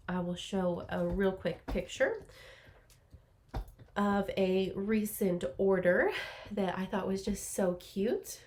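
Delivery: normal talking voice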